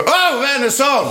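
high, slow drawn-out call; shouted warning. Retold or imitated. Defender calling to the keeper, high slow shout. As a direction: call out to the keeper as the ball flies. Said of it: "när jag ropar".

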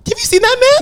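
can you believe it; telling friends about the rapper, incredulous. Real, not acted. Exclaiming at ugly rapper.

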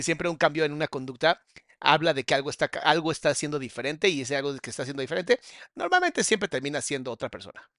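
usual speaking voice

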